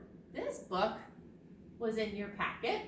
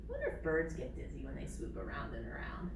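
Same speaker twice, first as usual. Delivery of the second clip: whisper; 10 semitones lower, 5 dB quieter, faster than usual. Real, not acted. Aside to viewers after spinning.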